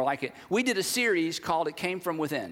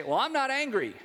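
higher-pitched, protesting little denial; mock denial; 6 semitones higher than usual. Man denying his anger when asked about feelings, mock protest. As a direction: act out someone insisting they are fine.

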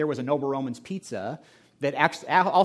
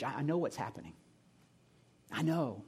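compassionate Jesus; 7 dB quieter than usual. Acted. Jesus speaks compassionately to churches.

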